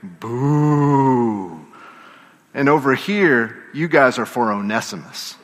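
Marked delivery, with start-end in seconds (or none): 0.0-1.6 long, drawn-out mock boo; demonstrated boo. Shown as a demonstration. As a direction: show the crowd how to jeer. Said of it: "you say".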